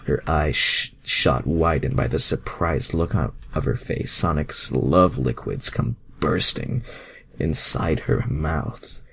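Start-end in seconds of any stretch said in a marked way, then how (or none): none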